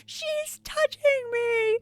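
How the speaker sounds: put-on high, whiny kid complaining to mom; whiny tattling little sibling. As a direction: tattle on your sibling, wailing. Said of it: "the other person would be like".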